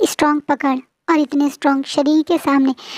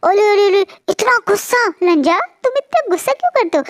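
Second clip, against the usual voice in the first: put-on pinching his cheeks and cooing at him, high baby talk; 7 semitones higher than usual. A narrator's reading. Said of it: "जैसे बच्चों को छुट करते हैं वैसे".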